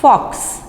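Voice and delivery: एक्स in "फॉक्स" said softly as a breathy k plus s; soft sound. Shown as a demonstration. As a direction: ease the ending of "फॉक्स" into a soft ks. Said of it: "बहुत ही soft sound है".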